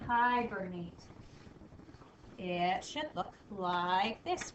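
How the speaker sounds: ordinary delivery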